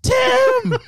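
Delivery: mock calling out. Imitating the girlfriend calling her boyfriend, high mock shout. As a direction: call out like a frantic girlfriend, hammed-up.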